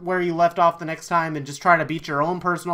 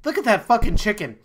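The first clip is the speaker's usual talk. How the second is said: normal talking voice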